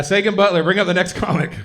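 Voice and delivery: calling out. Real, not acted. Host calling for the next comic, calling out.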